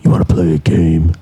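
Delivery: menacing puppet voice; Jigsaw voice. Retold or imitated. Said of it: "they're like".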